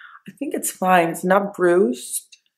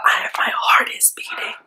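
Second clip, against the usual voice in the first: whispering. Real, not acted. Quiet waiting room before procedure.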